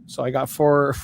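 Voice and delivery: ordinary talk, nothing marked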